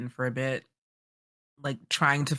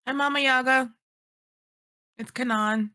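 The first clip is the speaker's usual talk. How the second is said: high, loud eager hello; nervous greeting; 11 semitones higher, 4 dB louder than usual. Acted. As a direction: greet the scary witch brightly.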